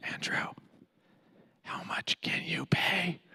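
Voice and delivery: hushed whisper in my other ear. Retold or imitated. Friend whispers the same question into my other ear. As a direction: lean in and whisper it again. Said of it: "he leans over and, no joke, in my other ear".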